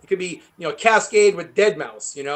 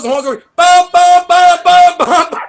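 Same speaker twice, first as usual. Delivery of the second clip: loud, higher "bum, bum" honks; horn imitation; 9 semitones higher, 9 dB louder, slower than usual. Imitating his brother's stuck Camaro horn, loud honks. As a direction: blast out the horn noise.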